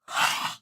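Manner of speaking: sharp loud gasp of disbelief; shocked gasp. Real, not acted. Reacting to a shocking dub scene, a loud gasp. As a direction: suck in air hard, stunned.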